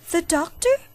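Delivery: startled at the word doctor; boy alarmed at a doctor visit. A narrator's reading. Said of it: "asked in alarm".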